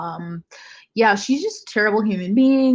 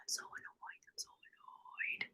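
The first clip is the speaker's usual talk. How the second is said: whisper; 27 dB quieter, slower than usual. Real, not acted. Whispered frustration at publishing news.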